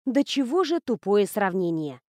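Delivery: ordinary delivery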